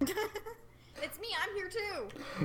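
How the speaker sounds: high, slow put-on voice; mock Aja. Co-reader as the ignored character, high put-on voice. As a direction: pipe up high, drawing it out.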